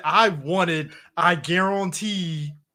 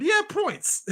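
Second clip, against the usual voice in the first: much higher, squeaky joke voice; squeaky punchline; slower than usual. Real, not acted. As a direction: pop the punchline up high. Joking punchline.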